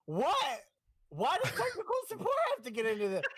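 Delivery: high, fast laughing disbelief; mock outrage, cracking up. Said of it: "I was like".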